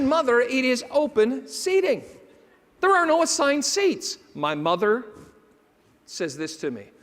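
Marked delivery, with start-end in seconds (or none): 0.0-4.2 exasperated. Retold or imitated. Exasperated son correcting mother.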